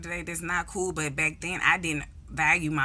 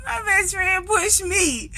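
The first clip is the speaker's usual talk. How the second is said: laughing; 13 semitones higher, 4 dB louder, slower than usual. Real, not acted. Laughing while retelling.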